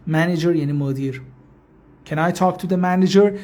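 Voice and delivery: ordinary talk, nothing marked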